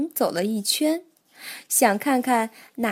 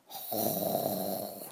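deep, soft snore; one long sleepy snore; snoring; 12 dB quieter than usual. Acted. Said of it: "蹦蹦畅快地打着呼噜".

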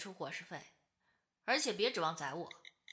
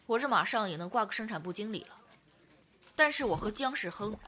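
ordinary talk

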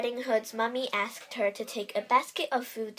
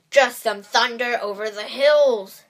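loud, sly wolf voice; sneaky wolf; 11 dB louder than usual. A narrator's reading. Wolf lies about his tummy rumbling, sly and loud. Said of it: "said the sneaky, hungry wolf".

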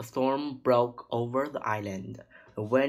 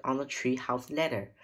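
ordinary delivery

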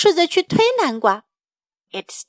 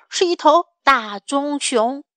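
ordinary delivery, nothing marked

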